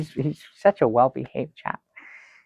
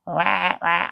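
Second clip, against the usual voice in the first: mock baby wail; 6 semitones higher than usual. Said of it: "he just goes".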